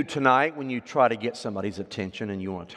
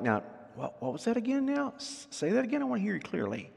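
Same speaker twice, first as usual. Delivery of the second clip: soft, higher asking again; straining to hear; 9 semitones higher, 7 dB quieter than usual. Retold or imitated. Listener straining to hear someone clearly, soft and puzzled. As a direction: lean in and ask them to repeat. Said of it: "the word give ear means you kinda like".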